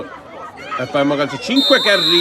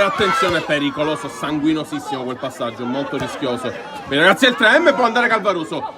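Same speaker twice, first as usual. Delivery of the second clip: ordinary talk, nothing marked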